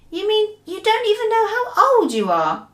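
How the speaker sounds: put-on high, amazed young girl; a girl in disbelief; little girl voice. Sophie amazed.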